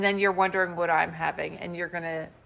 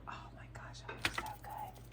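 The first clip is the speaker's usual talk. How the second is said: hushed whisper of delight; 15 dB quieter than usual. Real, not acted. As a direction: whisper it, marvelling at the food. Whispering over the food.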